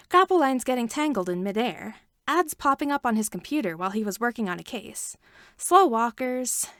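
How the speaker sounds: ordinary delivery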